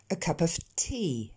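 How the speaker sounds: slow English "tea" with a puff of air after the t; plosives aspirées. Shown as a demonstration. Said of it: "rajouter comme une relâchée d'air derrière". (« tea » avec une relâchée d'air après le t)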